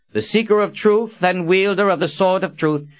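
high, formal herald announcement; officious. A narrator's reading. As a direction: proclaim it like a stiff court herald. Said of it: "Verdine said in an officious tone".

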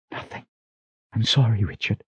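very soft, apologetic admission; defeated. A narrator's reading. Sister apologising to Richard for failing, defeated. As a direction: let it out hushed and beaten. Sister admits she has failed.

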